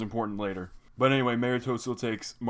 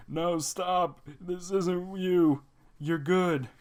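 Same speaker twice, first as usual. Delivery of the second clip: pleading plea; 8 semitones higher, much slower than usual. Acted. Polly pleading to stop Frobo.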